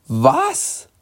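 high startled what; sudden astonished question; surprised. Acted. Latifa reacts to the strange request, astonished.

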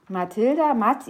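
plain reading voice

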